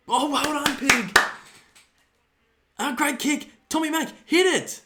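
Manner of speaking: loud, high excited cheering at the screen; yelling at the game. Real, not acted. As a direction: roar the team on like a fan on the couch. Yelling at the footy on TV.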